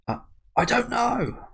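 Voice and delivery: high, slow, dramatized dread; acted disbelief. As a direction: stammer in, then stretch out the horror. Acting out a character's horrified disbelief.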